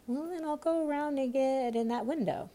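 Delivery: high rabbit voice; high-pitched muttered plan of a rabbit; rabbit talking to itself. A narrator's reading. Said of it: "Alice heard it say to itself".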